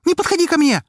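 very high, loud shriek; panicked scream. Acted. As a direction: scream it in terror from the window. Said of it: "Её крик заставил меня замереть". (истошный крик, очень высоко и громко)

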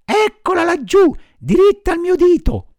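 put-on high, loud anxious old woman; shrill-free high elderly voice, distressed. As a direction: tell it like a worried old lady.

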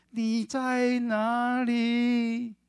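put-on very slow, high, sweet child calling mom; sweet little girl, long drawn-out. 女孩在电话里撒娇. (女儿在电话里拖长声音甜甜地问妈妈在哪)

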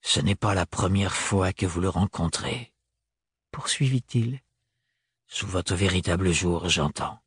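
low, controlled male character voice; restrained, formal and low; Thorn, stiff interrogation. A narrator's reading. Voices Thorn questioning Ophélie.

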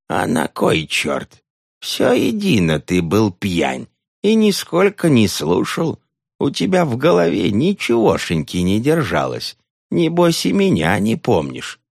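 lightly voiced cranky old nun; a hint of a cackling old woman.

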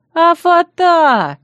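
high, loud, long drawn-out wail. A narrator's reading. Women wail after the bride about her veil, dismayed. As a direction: wail it out across a distance. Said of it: "снова заголосили мои мучительницы".

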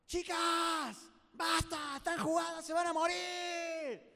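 high yell with drawn-out endings; shouted discouragement. Acted. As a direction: call down to them to give up. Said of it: "empecemos a decirle que basta". (gritos de desaliento, alargados)

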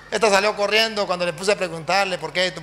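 ordinary talk, nothing marked

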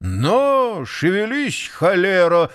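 slow, drawn-out driver's yell; the water carrier yelling at his nag as he jumps on; shout. A narrator's reading. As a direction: bark at the horse to move. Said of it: "закричал водовоз".

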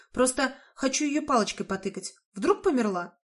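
ordinary delivery, nothing marked

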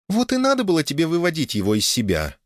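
high, carefree woman's voice; wife's voice. A narrator's reading. Wife chiding husband as she drives, high carefree voice. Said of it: "спросила Оля".

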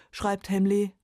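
ordinary delivery

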